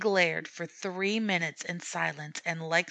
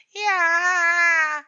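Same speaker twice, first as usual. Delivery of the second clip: very loud, high scream; screaming cat; 11 semitones higher, 10 dB louder than usual. A narrator's reading. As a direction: shriek it like a cornered tomcat.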